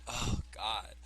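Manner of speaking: normal talking voice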